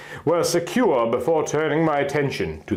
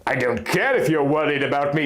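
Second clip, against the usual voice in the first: usual speaking voice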